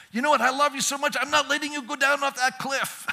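high, loud urgent pleading; impassioned plea. Acted. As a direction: plead as if hauling someone back from danger. Acts desperate plea at cliff edge.

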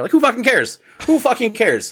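high exasperated outburst. Real, not acted. Fed up with gatekeepers, exasperated high outburst. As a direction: throw up your hands at it.

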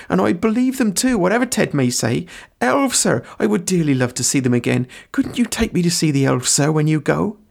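high, eager rustic voice; high-pitched breathless confession of wonder; dithering servant. Acted. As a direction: blurt it out as a humble, starstruck gardener. Sam confesses, begs to see elves.

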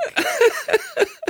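high laugh at own joke; corny dad laugh. Real, not acted. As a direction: laugh at your favourite pun. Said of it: "My favourite joke".

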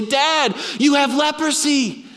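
put-on high alarmed shout of a kid; child's alarmed cry. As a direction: blurt it out like a frightened child. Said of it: "and they say".

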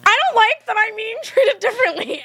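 hammed-up high complaint; mock whiny complaint. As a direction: complain high and put-upon. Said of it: "they were like".

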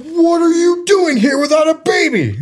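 hammed-up child outrage at parents; mock outraged shout. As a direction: yell it as a betrayed kid. Child outraged arriving home.

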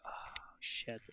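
breathless mock whisper; hushed dismayed whisper; whispered oh shit. Dismayed reaction in retold moment.

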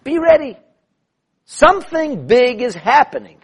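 high, loud warning call, slow on the second phrase; urgent proclamation. Acted. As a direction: call it out like a herald. Announcing the king's warning.

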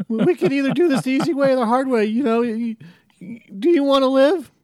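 a hint of panicky Morty holding a gun. To Rick at gunpoint, a light Morty voice. Reading Morty's lines in a scene.

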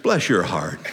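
low Texan sympathy, lightly put on. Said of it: "To give it to you in Texan".

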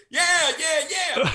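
mock exclamation.

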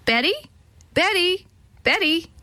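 high calling of a name. A narrator's reading. Old woman calls Betty to test parrot, calling out. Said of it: "the old woman called".

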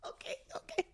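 breathless whispered okay. Real, not acted. Sister catching her breath after the fake sob, whispering. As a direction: hush it out under your breath.

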